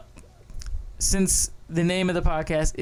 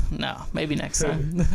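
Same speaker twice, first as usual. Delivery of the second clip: ordinary talk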